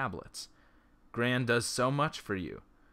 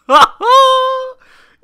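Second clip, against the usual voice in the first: high, loud burst of laughter; shrieking laugh; 23 dB louder than usual. Real, not acted. Player cracking up at the Jenny joke, shrieking laugh. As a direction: let out a real cackle of delight.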